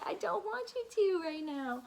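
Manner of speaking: mom voice. Real, not acted. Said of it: "sorry about the mom voice".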